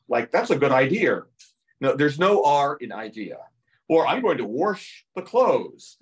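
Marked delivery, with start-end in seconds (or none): 0.3-1.2 "idea" said as "idear"; intrusive R. Shown as a demonstration. Showing how some cultures add an R, "idea" as "idear". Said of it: "put an R where they don't belong". 4.1-6.0 "wash" said as "warsh"; intrusive R. Shown as a demonstration. Showing the misplaced R in speech, "wash" as "warsh". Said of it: "There's no R in wash".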